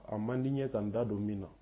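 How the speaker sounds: ordinary delivery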